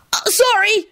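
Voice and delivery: high-pitched panicked apology; panic-stricken shriek. A narrator's reading. Boy who set tablecloth on fire, panic-stricken. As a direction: squeak it out in a panic. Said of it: "he shrieked in a panic-stricken voice".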